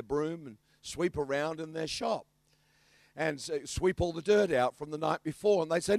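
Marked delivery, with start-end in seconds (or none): none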